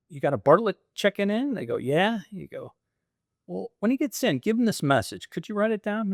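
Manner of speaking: leaving a message at the front desk; polite phone voice. Retold or imitated. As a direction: ask the desk clerk sweetly over the phone. Retold phone call to front desk.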